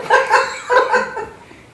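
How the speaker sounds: high helpless burst of laughter; real laughter. Real, not acted. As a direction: crack up laughing mid-reading. Breaks up reading hate mail.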